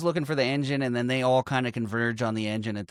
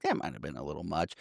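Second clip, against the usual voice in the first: low, soft, sheepish aside; a lightly voiced uneasy murmur; timid murmur; 10 semitones lower, 9 dB quieter, faster than usual. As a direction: mumble it like nervous office staff.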